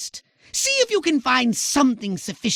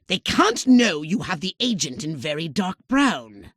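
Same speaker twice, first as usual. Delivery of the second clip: ordinary delivery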